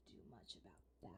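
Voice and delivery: whispered aside. Acted. Lily whispering to Helix about Thea. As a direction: whisper it behind her back.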